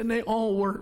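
normal talking voice

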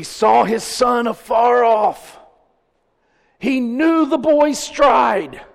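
loud, high, drawn-out proclamation; full-force preaching. Acted. Preacher telling of the father spotting his son, proclaiming. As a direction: preach it out, voice lifted and stretched.

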